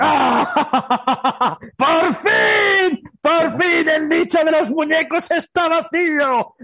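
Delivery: gritos de villano victorioso, risa y proclamas; villano triunfal. Acted. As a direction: celebra como un malvado que por fin gana. Villano triunfal, plan de dominación. (villano gritón celebrando su triunfo)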